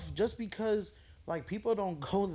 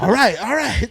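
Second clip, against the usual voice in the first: louder, higher mock-irritated "All right, all right"; madder; 6 semitones higher, 11 dB louder than usual. Bombing comic after the walk-off, louder mock irritation. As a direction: imitate the comic getting madder, laughing through it. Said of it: "he would be madder".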